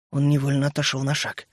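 plain reading voice

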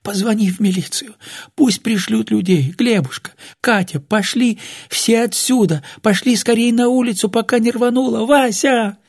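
high, strained, fearful pleading; imploring. A narrator's reading. Old woman begs all to leave before it explodes, imploring. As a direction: urge everyone out, begging. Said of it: "взмолилась Глафира".

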